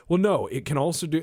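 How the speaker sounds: high, loud, hurried fan rebuttal; mock protesting. Fan insisting a game is different, mock protesting. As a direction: rush in with a pushy objection. Acting out fans still protesting.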